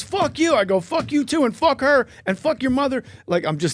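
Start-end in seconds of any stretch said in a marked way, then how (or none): 0.0-0.6 angry retort. Retold or imitated. Heckler swears back. 0.8-3.0 high yelled insults at a heckler; screaming insults. Retold or imitated. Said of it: "you're off script".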